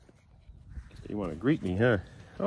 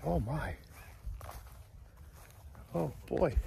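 ordinary talk

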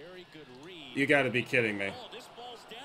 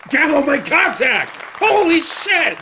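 high, loud, thrilled yell; excited shout; 8 semitones higher, 5 dB louder, slower than usual. Real, not acted. Gamer yelling as his slugger finally connects, excited shout. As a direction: shout it out in disbelief at the hit. Big hit in the game.

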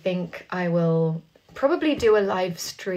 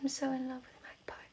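soft breathy whisper; 6 semitones higher, 15 dB quieter than usual. Real, not acted. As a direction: whisper it like a secret. Whispered confession about the song.

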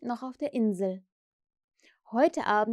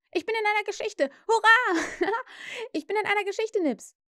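put-on high, squealing child's delight; overjoyed; 11 semitones higher, 4 dB louder than usual. A narrator's reading. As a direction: cheer with a gleeful laugh.

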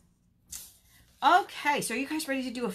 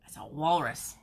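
ordinary delivery, nothing marked

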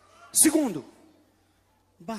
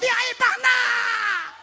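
brash loud greeting; 12 semitones higher, 7 dB louder, much slower than usual. Retold or imitated. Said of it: "chega o outro todo".

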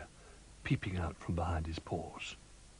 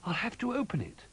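high little bear cry. A narrator's reading. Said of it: "he cried".

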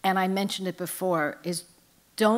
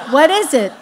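a hint of a startled mother, raised and louder; mother's alarmed question; 7 semitones higher, 10 dB louder than usual. Mother to daughter, mock alarmed and loud. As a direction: mom snapping back what is it.

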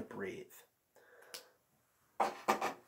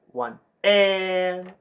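"One" and "and" pushed louder, landing hard on "and"; brought-up accent; 4 semitones higher, 5 dB louder than usual. Shown as a demonstration. Teacher demonstrates the accent to the band, pushed louder. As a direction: punch the accented beat. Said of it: "Notice how I brought up that accent?".

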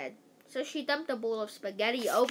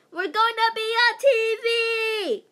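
put-on much higher, much louder girlish scream; screaming with excitement; 10 semitones higher, 10 dB louder than usual. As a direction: shriek it like thrilled schoolgirls. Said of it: "girls started screaming and freaking out".

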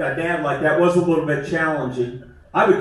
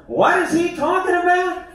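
high, baffled mock question; puzzled audience voice; 13 semitones higher than usual. Comedian voicing confused young audience members, high and baffled. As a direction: voice a lost young listener, pitched way up.

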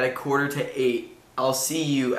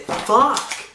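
high, loud curse at himself; frustrated outburst; 11 semitones higher, 4 dB louder than usual. Real, not acted. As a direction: blurt out the swear in frustration. Flubs sheep twister, frustrated outburst.

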